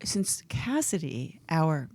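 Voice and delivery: slow, hushed mock menace; mock "I'm gonna kill her" voice. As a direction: speak slowly and softly, as if barely restraining yourself. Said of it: "it's my I'm gonna kill her voice".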